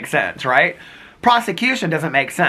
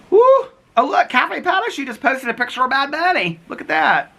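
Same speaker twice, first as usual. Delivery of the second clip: much higher, gushing voice; excited squeal; 12 semitones higher than usual. Real, not acted. Excitedly reading a post.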